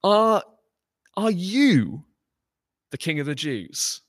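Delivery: high, pressing "Are, are you" stress; emphatic question. Acted. Pilate asking Jesus in disbelief, high and emphatic. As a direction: lean hard into the disbelieving question. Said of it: "he asks with emphatic emphasis".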